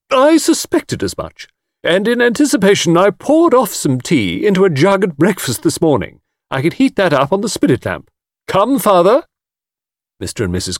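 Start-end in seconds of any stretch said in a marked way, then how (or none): 0.1-9.2 high, triumphant matron; springing a mine. A narrator's reading. Mrs Caldecott outwitting the guide, triumphant. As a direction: announce it as a crushing surprise. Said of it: "the air of one springing a mine".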